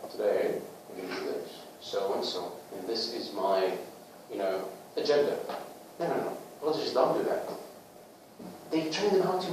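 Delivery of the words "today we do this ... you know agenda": low, quiet speech drained of feeling; subdued, unemotional mock political address; emotionless politician. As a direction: deliver it like a dull politician, no emotion. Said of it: "a message without emotion".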